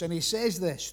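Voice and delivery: usual speaking voice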